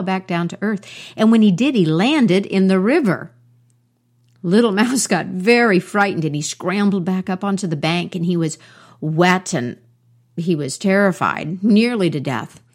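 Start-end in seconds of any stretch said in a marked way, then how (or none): 1.1-6.2 louder, building tension; dramatic narration. A narrator's reading. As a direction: tell the fall with rising drama. Dramatic fall into river.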